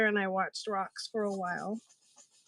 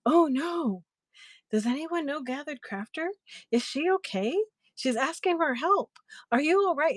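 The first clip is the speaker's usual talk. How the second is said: higher, anxious mock concern; worried lady; 6 semitones higher than usual. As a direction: play the panicked seller asking if she's okay. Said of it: "she's like".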